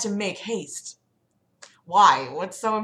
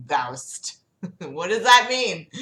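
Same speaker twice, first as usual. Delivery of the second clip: plain reading voice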